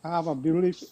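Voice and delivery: normal talking voice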